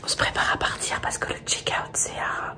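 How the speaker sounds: hushed whisper, lost voice. Real, not acted. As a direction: whisper close to the mic. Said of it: "Je vais chuchoter comme ça".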